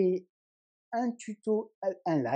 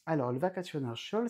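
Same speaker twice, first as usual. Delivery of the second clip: ordinary talk, nothing marked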